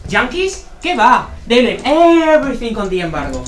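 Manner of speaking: loud indignant outburst; outraged shout. Acted. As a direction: shout it back in disbelief. Outraged reply to the embargo remark.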